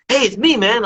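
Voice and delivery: friend revealing himself. Retold or imitated.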